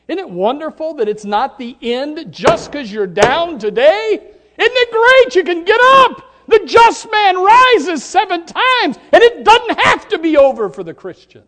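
soaring high, loud climax of the sermon; preaching at full force. Acted. Preacher to his congregation, ringing high loud preaching. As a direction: lift them up with a ringing cry.

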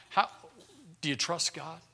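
ordinary talk, nothing marked

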